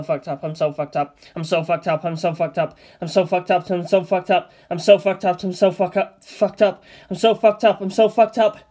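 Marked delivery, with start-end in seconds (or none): none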